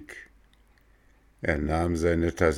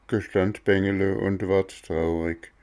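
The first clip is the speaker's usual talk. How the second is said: usual speaking voice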